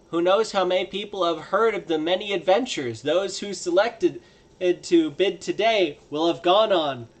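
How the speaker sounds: emphatic hawker addressing a crowd; auctioneer's sales pitch. Acted.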